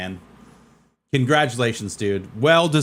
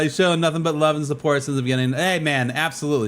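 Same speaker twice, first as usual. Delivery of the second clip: ordinary delivery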